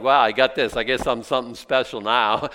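loud, cocky self-congratulation; mock boast. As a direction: brag like you've suddenly arrived. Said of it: "It's not like".

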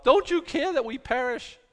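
high-pitched, alarmed appeal; mock desperate plea. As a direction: cry out as a panicked disciple. Panicked disciples waking Jesus in storm.

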